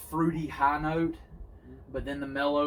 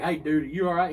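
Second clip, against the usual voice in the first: louder, raised call to a coughing buddy; retold call out; 4 semitones higher, 10 dB louder than usual. Driver calling back to his coughing partner, raised voice. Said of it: "I was like".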